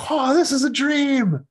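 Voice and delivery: high, loud gleeful shout; excited realization. Acted. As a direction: shout it like you just caught on. Dreamer realizes it's a dream.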